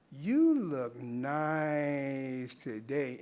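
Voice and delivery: drawn-out, creepy compliment; lingering come-on, crowding the listener; suggestive leering. Shown as a demonstration. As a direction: drag out NICE very slowly, loaded with innuendo. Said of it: "Same verbiage".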